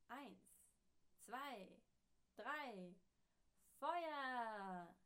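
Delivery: countdown to a shout. Acted. General Kaputt firing the cannon, countdown building to a shout. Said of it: "rief General Kaputt".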